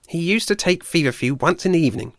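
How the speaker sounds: a fed-up forager venting; irritable squirrel. Acted. Ashford complaining to Blue Tongue about the badger, fed-up voice.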